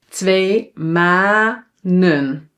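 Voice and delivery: "Twee manen" very slowly, stretching each syllable; drawn out so learners hear "ma-nen"; teacher modelling two syllables; slow Dutch demo. Shown as a demonstration. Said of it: "So two syllables".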